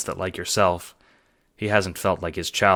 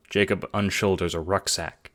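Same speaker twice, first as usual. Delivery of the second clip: usual speaking voice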